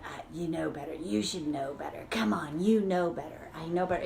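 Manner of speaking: inner bully. Shown as a demonstration. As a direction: whisper it like a nagging critic. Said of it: "that's the bully that's going".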